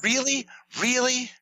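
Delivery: high-pitched mock disbelief; incredulous. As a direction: squeak it out, utterly incredulous. Acting out disbelief on stage.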